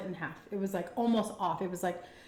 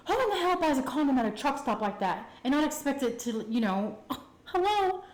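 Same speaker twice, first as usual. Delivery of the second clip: retold indignation; 6 semitones higher than usual. Girl mocking boyfriend's truck-stop purchase, incredulous indignation. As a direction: call out the stupidity, pitch raised.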